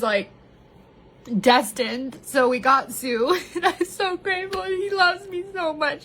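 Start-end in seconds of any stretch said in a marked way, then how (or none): 3.6-6.0 choked up with love for her dog; tearful gratitude. Real, not acted. Vlogger tells viewers about her puppy, voice welling up.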